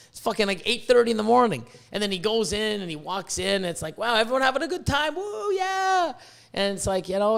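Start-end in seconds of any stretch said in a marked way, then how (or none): 4.0-6.1 imitating an excited host. Imitates expo host walking in.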